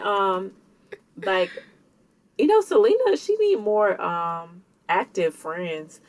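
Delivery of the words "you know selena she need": sudden squeak on Selena; jumping high. Real, not acted. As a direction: voice jumps way up on the name.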